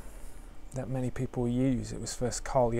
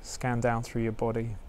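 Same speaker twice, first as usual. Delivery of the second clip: guided meditation; 4 dB quieter than usual. Acted. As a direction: ease listeners into stillness gently.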